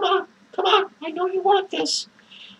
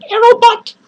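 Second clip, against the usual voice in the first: mocking shout; 5 semitones higher, 10 dB louder than usual. Mocking band name.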